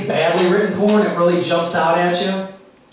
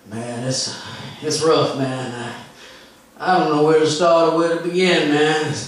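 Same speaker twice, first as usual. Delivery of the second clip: impression of stoned comic; 3 semitones lower than usual. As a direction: sink into a dazed, nodding-off junkie voice. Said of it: "I'm going to do his set for you right now, verbatim".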